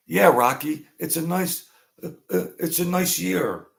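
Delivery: high, hammed-up wine-loving mobster; mock wiseguy. Mob guys at a sit-down, hammed-up high wine snob.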